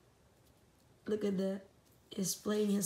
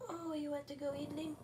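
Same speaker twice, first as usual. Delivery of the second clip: usual speaking voice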